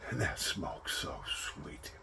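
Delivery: savouring whisper, slow. Real, not acted. Savouring his first puff, slow whisper. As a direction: breathe it out slowly, whispering.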